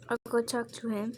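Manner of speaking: ordinary talk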